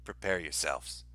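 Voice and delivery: hushed, low doomsday voice; ominous prophet voice. Pretend. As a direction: intone it like a doom-sayer. Mock ominous warning, repeated plainly.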